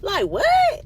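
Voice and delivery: high, loud outraged question; incredulous. Retold or imitated. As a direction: react as if you can't believe your ears. Disbelief at the lady's nerve.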